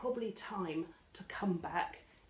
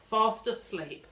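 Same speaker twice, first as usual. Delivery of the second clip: usual speaking voice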